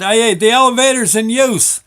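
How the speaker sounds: shouting down the elevator shaft; hollering down. Retold or imitated. Man stuck in elevator hollers down to those below, loud. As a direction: yell it down from high up. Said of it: "I holler down".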